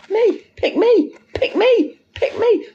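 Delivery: very high, squeaky mock pleading; mocking pick-me voice. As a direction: squeal it like someone begging to be chosen. Mocks the pick-me's.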